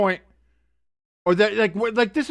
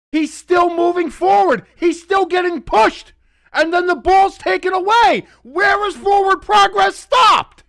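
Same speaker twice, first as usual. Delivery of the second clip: higher, louder disbelieving yell; outraged shouting; 8 semitones higher, 4 dB louder than usual. Real, not acted. Fan shouting at refs about forward progress, outraged. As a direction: demand an answer from the refs.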